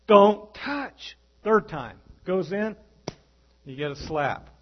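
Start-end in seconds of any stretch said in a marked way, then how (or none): 0.1-1.2 louder, firmer don't touch; mock mother snapping at a child; second warning at the cookie jar, louder and firmer; sharper mommy warning. Mother warns child again.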